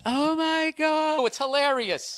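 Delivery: high, slow, drawn-out "Oh my God"; exasperated girlfriend. Retold or imitated. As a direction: let it drag out in disbelief.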